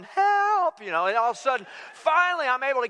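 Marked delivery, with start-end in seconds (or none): 0.0-0.8 very high, stretched mock yell; retold cry for help. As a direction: act out a strained yell for rescue. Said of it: "and I'm yelling".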